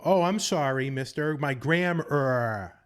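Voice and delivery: hammed-up Boston sarcasm, "grammar" stretched into "gram-urr-ah"; overcorrected Boston accent. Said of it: "overcorrect it in comical ways".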